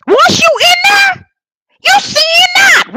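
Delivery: high, loud cry of conviction; preaching shout. Real, not acted. Preacher crying out to his congregation, high and loud. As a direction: cry it out from the pulpit.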